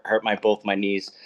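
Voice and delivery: usual speaking voice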